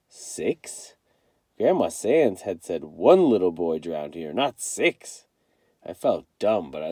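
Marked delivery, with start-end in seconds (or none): 0.1-0.9 breathless whisper; whispered shock. A narrator's reading. Kenny reads the death count, shocked whisper. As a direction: gasp the number under your breath.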